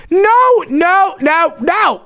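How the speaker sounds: high, forceful string of no's; appalled refusal. Acted. Hearing friend's plan to trap the viewer, appalled. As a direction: shut the idea down in alarm.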